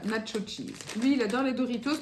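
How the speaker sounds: normal talking voice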